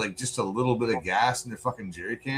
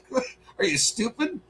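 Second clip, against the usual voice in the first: high-pitched incredulous question through a laugh; laughing disbelief. Real, not acted. Telling a friend about a neighborhood run-in, laughing in disbelief.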